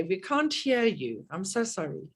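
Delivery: ordinary talk, nothing marked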